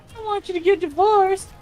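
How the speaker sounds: put-on high little-girl voice, begging; pleading daughter. Daughter begging parents not to divorce, high child voice.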